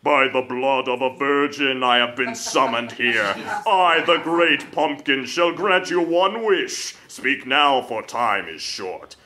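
slow and low like an ancient demon summoned; low slow monster voice; deep demon voice. Acted. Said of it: "a deep voice echoed from within the flames".

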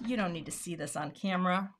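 ordinary talk, nothing marked

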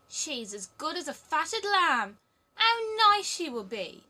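high, loud, slow gloating hag; greedy old robber woman. Acted. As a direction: savour the plump child like a meal. Robber woman relishes her prey.

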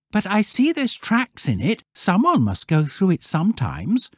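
put-on high-pitched boy's voice; observant schoolboy. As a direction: lad reasoning, voice well up. Voices Wilfred.

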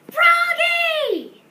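very high, very loud shout of his name; loud reading. Acted. Froggy proudly reading his name tag, shouting it high and loud. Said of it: "He read it out loud".

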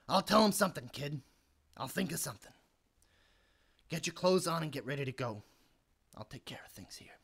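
very soft, low, resigned; deflated. Acted. Uncle will cover for the kid, weary and subdued. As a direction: let the anger drain away, trailing off.

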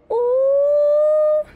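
sharp high gasp into a thrilled ooh; excited gasp. Real, not acted. Streamer gasps at a slick play, high thrilled ooh. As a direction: gasp like you just saw something amazing. Gasp at a sudden in-game win.

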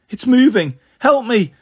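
put-on high, loud child's cry for help; terrified scream. A narrator's reading. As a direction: scream in panic as the branch gives way. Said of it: "Bliss screamed".

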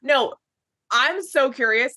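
usual speaking voice

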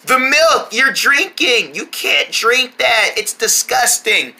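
slowed-down pleading; slow motion. Acted. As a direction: stretch every word in slow motion. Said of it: "talking in slow motion".

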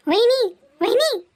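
high drawn-out call of vahini. Acted. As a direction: stretch the name out, calling from off. Calling out to sister-in-law.

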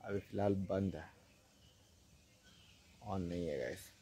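hushed, low-pitched vlogging voice; speaking softly. Real, not acted. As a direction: keep it down so the guards don't overhear. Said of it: "मेरे को धीरे धीरे बोलना पड़ रहा है". (धीमी, दबी आवाज़ में)

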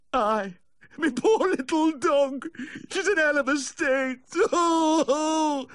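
high, loud, drawn-out lament dissolving into sobs; tearful wailing. A narrator's reading. Dog owner telling the vet of poor Myrtle, sobbing. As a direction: wail it out and break down on the "oh". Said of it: "pleading between sobs".

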